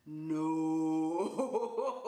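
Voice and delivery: long, drawn-out comic "no"; slow-motion no. Retold or imitated. John watching her reach for the cat, slow-motion cry. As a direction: stretch it like a slow-motion film shout. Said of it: "like, slow motion".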